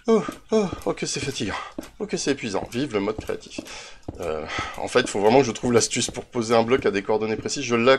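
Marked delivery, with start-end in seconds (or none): 0.1-2.7 higher, put-on weary sighing; mock exhaustion. Player joking about creative-mode climbing, mock exhausted. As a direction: feign being worn out by the climb.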